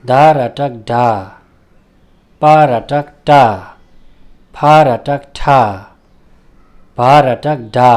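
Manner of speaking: slow, measured recitation of each syllable with long pauses; spelling drill. Shown as a demonstration. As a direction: read each letter cluster out clearly, slowly. Teacher recites letter drill. (धीमा, स्पष्ट वर्ण-उच्चारण अभ्यास)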